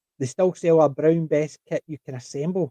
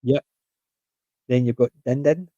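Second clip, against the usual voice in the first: ordinary talk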